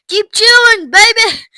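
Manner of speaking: loud, high encouragement; proud cheering. A narrator's reading. Mister Beauregard urging his daughter on, loudly proud. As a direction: egg her on like a booster.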